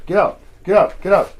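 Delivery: loud, firm command to get out; shouting at dog. Real, not acted. Owner shouting at dog to leave the kennel, loud.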